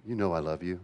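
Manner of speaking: very soft, low, gentle voice; tender reassurance. Acted. Jesus looks back at the disciples, soft tender reassurance. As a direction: reassure them almost under your breath.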